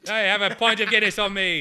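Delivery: put-on playful stab at an Irish accent, pint as poynt, thanking a fan from Ireland; mock Irish accent. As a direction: streamer dares a quick Irish accent for an Irish fan's pint of Guinness. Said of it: "I was this close to doing an accent".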